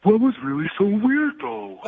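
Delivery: put-on cartoon dimwit; Patrick. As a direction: play it as a dopey cartoon character. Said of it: "stop with the Patrick voice".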